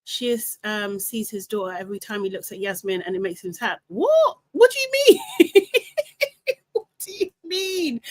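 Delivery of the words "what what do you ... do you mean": high, laughing what do you mean; shocked disbelief. Real, not acted. Host reacting to shocking comment, high and laughing.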